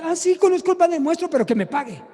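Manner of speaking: high, dismissive señora voice; pitched high, then dropping on the demand; insistent woman. Retold or imitated. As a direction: imitate a stubborn woman brushing off a question. Retold señora brushing off the question.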